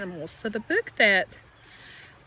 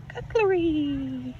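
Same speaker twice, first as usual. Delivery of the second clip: bird call imitation; 7 semitones higher, much slower than usual. A narrator's reading.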